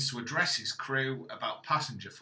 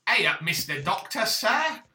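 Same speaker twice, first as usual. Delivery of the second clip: hammed-up mock Cockney, louder, squeaking up high; 7 semitones higher, 6 dB louder than usual. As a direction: play it as an overdone music-hall Cockney.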